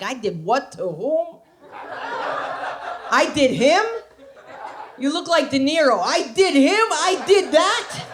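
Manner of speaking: high, slow incredulous disbelief; shocked at diaries. Retold or imitated. Woman reading old diaries, incredulous and high. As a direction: gasp at your own past. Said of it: "you're like".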